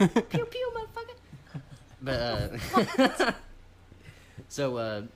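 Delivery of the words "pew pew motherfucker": high, loud pew pew noises; mock laser gun. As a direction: shoot cartoon blasters with your mouth. Mock gun sound effect.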